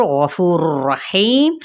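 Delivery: slow Quranic chant; recitation. Acted. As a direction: draw the words out slowly. Recitation demo.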